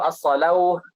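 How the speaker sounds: "as-salawuh" with the waw sounded as wu; untaught misreading. Shown as a demonstration. Imitating another untaught guess, "as-salawuh" with spoken w. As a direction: end "as-salawuh" on a wu syllable. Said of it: "kalau kita nggak ngaji".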